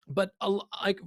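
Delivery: usual speaking voice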